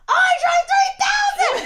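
very high, loud, slow laughing shriek; starstruck shriek. Retold or imitated. Recognizing Andre outside Krispy Kreme, shrieking high. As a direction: shriek at spotting a star.

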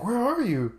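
high-pitched, incredulous question; imitated disbelief. Acting out friend's puzzled question.

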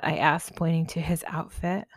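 usual speaking voice

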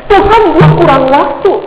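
high, put-on plea to God; mock complaint. Preacher mimics someone telling God time is short, mock complaint. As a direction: whine it up high as a mock grievance. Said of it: "dari kita yang bisa bilang".

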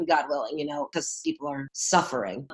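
ordinary talk

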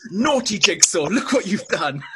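high, loud playful scolding; mock telling-off. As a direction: scold like a naughty child is caught. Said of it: "when you wanna tell him off".

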